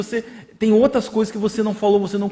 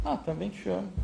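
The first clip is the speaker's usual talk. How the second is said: much softer, a flat dismissive mumble; bored indifference; 2 semitones higher, 11 dB quieter than usual. Acted. Husband brushing off his wife's I love you, uninterested. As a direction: toss it off like you couldn't care less. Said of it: "foi de desinteresse, foi de descaso".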